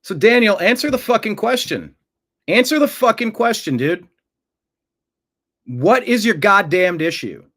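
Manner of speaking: loud, high-pitched angry demand; angry confrontation. Real, not acted. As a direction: demand an answer from a troll, loud and heated. Host confronts troll making death threats.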